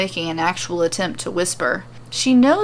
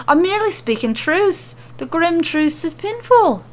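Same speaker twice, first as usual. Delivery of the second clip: higher, grave mock-solemn pronouncement; dour; 10 semitones higher than usual. A narrator's reading. Becky to her friends, dour and grave about Pinfall. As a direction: intone it like a doom prophet. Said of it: "very dour again".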